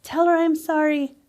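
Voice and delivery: lightly voiced man asking forgiveness; pleading spirit. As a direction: plead like a sorry ghost. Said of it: "with his hands up saying".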